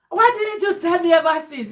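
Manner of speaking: loud, high-pitched mock demand; retold indignant protest. Retold reproach to spouse.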